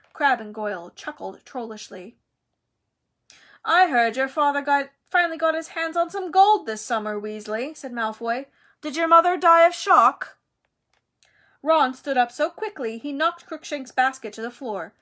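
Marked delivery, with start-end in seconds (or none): 3.6-10.4 put-on higher, louder sneering boy's voice; Malfoy's nasty taunt; taunting bully. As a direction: provoke Ron with a cruel jibe.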